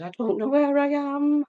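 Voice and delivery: high, wavering confused voice; lost old resident. Retold or imitated.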